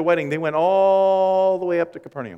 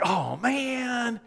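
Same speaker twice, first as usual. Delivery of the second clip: mock groan; 9 semitones higher, 3 dB quieter than usual. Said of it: "It's like".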